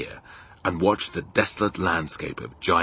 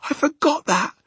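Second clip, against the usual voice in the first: Sophie; 6 dB louder than usual. Acted. Sophie's reply.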